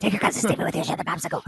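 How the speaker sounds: hurried, laughing Sicilian grandpa rasp; fast Sicilian accent. Retold or imitated. Grandpa repeats his order to share, fast laughing Sicilian accent. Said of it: "very thick Sicilian accent".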